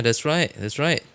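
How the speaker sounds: normal talking voice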